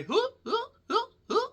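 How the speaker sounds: very high squeaky hiccups; imitated hiccups. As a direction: squeak out nervous hiccups, evenly spaced. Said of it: "a particular tick that people have when".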